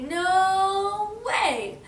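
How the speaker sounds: high, loud storybook refusal; defiant pig. Acted. Pig refuses the wolf, high loud defiance. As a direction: stretch it out stubbornly.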